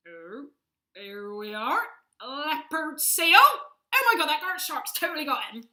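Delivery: West Country presenter building to a loud, high shout; hammed-up Bristolian wildlife commentary, exploding into yells; Bristolian nature presenter. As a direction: get wildly over-excited in a Bristol accent. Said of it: "can you imagine a nature programme".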